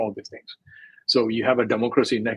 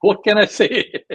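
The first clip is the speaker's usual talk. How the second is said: higher, louder, slow "what can I say"; mock exasperated quote; 10 semitones higher, 8 dB louder, slower than usual. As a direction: throw it up high like a helpless shrug. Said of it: "if you look at mica and you think".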